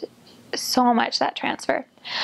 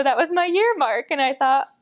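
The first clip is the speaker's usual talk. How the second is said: normal talking voice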